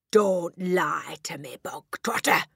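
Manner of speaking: loud, slow, menacing bark. A narrator's reading. Headmistress warning the lying boy, barking. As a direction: bark it right at him. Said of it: "barked the Trunchbull".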